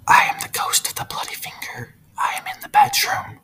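spooky whispered ghost voice; ghostly whisper. Acted. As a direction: whisper it, now in the room. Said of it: "The door creaked open".

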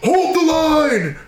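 slow high shout, laughing through it; hammed-up order to hold the line; mock battle cry. Imagining facing elephants, a laughing mock battle cry. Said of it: "I'd be like".

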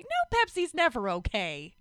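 a hint of a picky customer refusing Pepsi; mock diner. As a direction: toss it off as a fussy customer.

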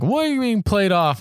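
high-pitched retold challenge, falling away; imitating a baffled objection; mock incredulous challenge. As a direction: jump up high as if calling someone out. Retells judge's indignant challenge.

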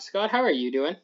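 usual speaking voice

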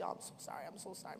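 soft, whispered apology; near-tearful whisper. Retold or imitated. Chrissy apologizing to Miss Grace, near tears. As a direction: apologize softly, close to tears. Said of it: "she's almost in tears".

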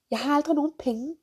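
mock moan about never having money; imitating a whiner. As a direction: play the broke complainer. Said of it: "Men du skal bo i den".

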